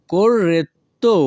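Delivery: corretto drawn out, "cor-ret... to"; slow corretto with a gap before the last syllable. Shown as a demonstration. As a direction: separate the final "to" of corretto. Teacher repeats word split for learners.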